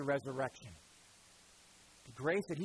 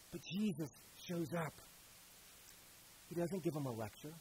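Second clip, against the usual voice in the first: ordinary delivery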